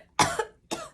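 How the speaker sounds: a sharp mock choking cough; imitated choking cough. Patient choking on ginger ale, mock cough. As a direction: act out the choking. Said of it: "I was like".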